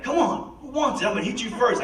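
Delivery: high-pitched mock fighting challenge, daring anyone to come at him; violent challenge. Patient in rehab challenging staff, agitated and violent. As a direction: rev up like a cornered man waving a shower rod. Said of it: "I became violent and agitated".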